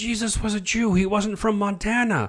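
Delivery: high-pitched, astonished revelation; amazed. Retold or imitated. Man telling his friend a discovery, amazed. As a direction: break startling news in a high, wondering voice.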